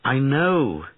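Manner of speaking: a quick heartfelt exclamation; crying out. Acted. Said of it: "cried the queen".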